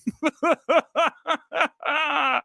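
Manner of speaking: high giggling laugh; laughing fit. Real, not acted. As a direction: burst into helpless laughter. Laughing at theater name.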